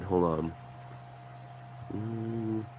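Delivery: sniffly, runny nose; sniffling. Real, not acted. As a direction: talk while sniffling. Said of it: "Excuse the sn-, sniffling".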